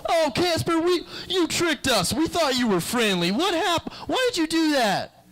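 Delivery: high, whiny betrayed wail; freaked-out friends. Acted. Friends betrayed by the cookie trick, high whine.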